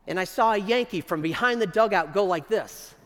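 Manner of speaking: usual speaking voice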